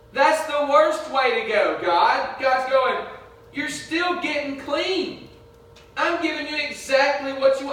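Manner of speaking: high, insistent sermon voice; impassioned preaching. Real, not acted. Preacher urging the congregation to accept God's direction, impassioned preaching.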